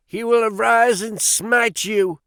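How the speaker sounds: high, loud hammed-up wrathful prophecy; cried out. A narrator's reading. Young Drebber threatening the farmer, loud wrathful cry. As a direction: call down the Lord's wrath on him. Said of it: "cried young Drebber".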